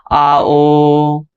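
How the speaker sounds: आ and ओ each stretched to triple length; pluta-length आ and ओ; drawn-out vowels. Shown as a demonstration. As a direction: hold the vowels आ, ओ long and steady. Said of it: "तिगुना समय".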